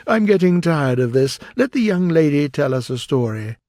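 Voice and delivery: ordinary delivery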